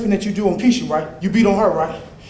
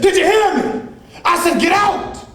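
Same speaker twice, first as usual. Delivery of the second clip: angry shout; 10 semitones higher than usual. Acted. Man ordering his abusive friend out, angry shout. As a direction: order him out at full anger.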